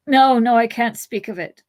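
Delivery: wounded hobbit refusing to relive horror; distressed. Acted. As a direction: push the memory away in distress. Merry recoiling from memory.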